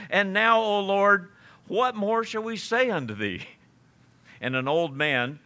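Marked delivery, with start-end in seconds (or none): 0.1-3.5 high, louder mock-solemn praying voice; long-winded public prayer. Preacher voicing the stranger ending his long prayer, high and declamatory. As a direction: call it out high like an orating supplicant. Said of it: "He said at the end".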